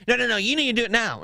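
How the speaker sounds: rapid-fire mock insistence; insistent. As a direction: imitate someone bossily insisting. Acting out pushy woman quote.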